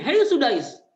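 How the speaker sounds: sharp high yell of a name; a mother yelling at her son who wrecked the meal; mother's angry shout. Retold or imitated. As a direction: snap at the naughty boy at full voice. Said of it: "Sampai bentak begini".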